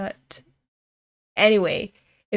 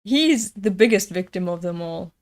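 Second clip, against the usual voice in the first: normal talking voice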